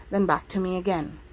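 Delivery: ordinary delivery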